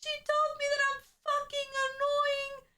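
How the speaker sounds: put-on very high wailing child voice; exaggerated imitation of a tearful tattletale; mock crying tattle. Kid telling on the worker to the teacher, mock wail.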